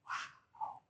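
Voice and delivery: barely audible breath of wonder; awed whisper. Pretend. Storyteller marvels at the stilled storm, awed whisper. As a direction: whisper it in awe.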